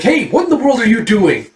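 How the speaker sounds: high, outraged protest; indignant shout-up in pitch; acted alarm. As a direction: cry out in dismay at sabotage. Villain sees letters wiped out.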